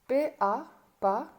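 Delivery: teacher's spelled-out "P-A, pa... et pion"; each piece of "P-A, pa, et pion" set apart; syllable by syllable. Shown as a demonstration. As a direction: spell P-A, blend it to "pa", pause, then "pion". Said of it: "J'ai séparé le mot en syllabes".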